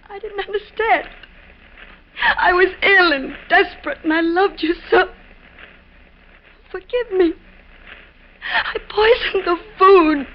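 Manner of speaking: sobbing apology; weeping confession. Acted. Wife sobbing her confession to dying husband. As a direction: break down as you confess.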